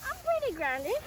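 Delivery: high, loud and giddy; laughing giddy delight; excited. Real, not acted. After jumping, buried in grass.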